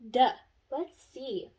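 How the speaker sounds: ordinary talk, nothing marked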